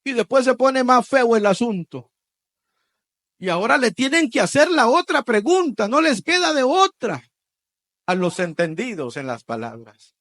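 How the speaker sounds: ordinary talk, nothing marked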